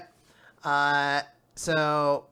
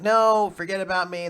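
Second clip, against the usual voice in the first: pleading shout; 6 semitones higher, 5 dB louder than usual. Retold or imitated. Mother begging rescuers to leave.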